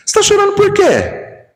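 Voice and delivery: high, scornful voice of a judging man; mocking scold. Man at the wake mocking his tears, high and scornful. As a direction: sneer at the grieving man. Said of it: "espírita de porco e fala assim".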